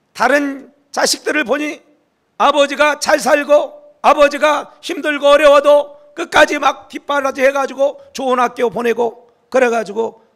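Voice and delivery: loud, high, slow outcry at God; complaining prayer. Retold or imitated. Pastor retelling his own complaint to God, as a loud bitter outcry. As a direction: reenact crying out bitterly to God. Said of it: "제가 막 불평했다니까요".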